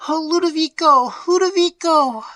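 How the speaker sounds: high, loud anguished wail of a maid; weeping cry. A narrator's reading. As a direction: cry out his name in grief. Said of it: "came weeping and sobbing".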